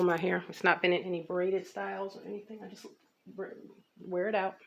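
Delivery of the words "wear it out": normal talking voice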